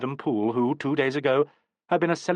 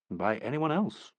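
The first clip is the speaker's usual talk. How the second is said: slow, pointed afterthought; change of tone; 5 dB quieter, slower than usual. A narrator's reading. Poole to Martin, slow pointed warning. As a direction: drop into a meaningful aside. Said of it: "he added with a change of tone".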